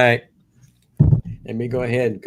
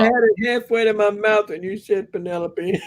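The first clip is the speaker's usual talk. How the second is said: talking through real laughter; laughing; 8 semitones higher than usual. Real, not acted. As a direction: still giggling while getting the words out. Laughing while explaining.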